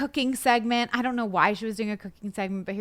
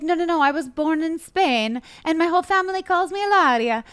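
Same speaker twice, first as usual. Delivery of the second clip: fake Spanish accent; 6 semitones higher than usual. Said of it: "With her accent".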